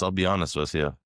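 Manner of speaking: ordinary talk, nothing marked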